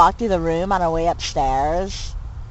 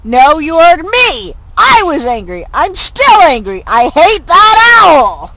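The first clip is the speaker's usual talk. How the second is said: loud, high furious rant, slowing to the end; hammed-up furious rant at the owl; 8 semitones higher, 9 dB louder, slower than usual. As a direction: scream how much you hate that owl. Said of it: "do you think Mervyn hates the owl".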